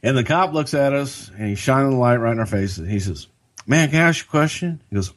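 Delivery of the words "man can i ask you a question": higher, quicker cop voice; an eager cop leaning in; mock cop asking fast; cop's voice. Cop voiced in retold story.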